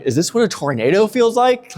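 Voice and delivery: high-pitched, incredulous question; acted disbelief, pitched well up; imitated alarmed wonder. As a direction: wonder aloud in mock alarm, voice climbing. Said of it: "I'm thinking, like".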